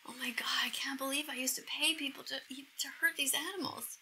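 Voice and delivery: soft, near-tearful confession; hushed disbelief at oneself; quiet, breath-heavy remorse; guilt-stricken. Real, not acted.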